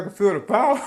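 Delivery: high, loud, hammed-up exclaim; Carlton impression. Preacher quoting Carlton from Fresh Prince, high and loud. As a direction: ham it up like a sitcom nerd feeling the power. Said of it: "He's like".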